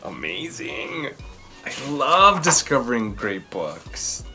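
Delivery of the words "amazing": usual speaking voice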